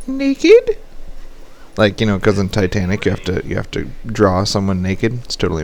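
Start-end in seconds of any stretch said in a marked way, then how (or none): 0.1-0.8 squeaky question. Retold or imitated. Teasing reply in retold chat.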